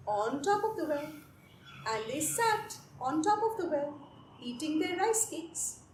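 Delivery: ordinary delivery, nothing marked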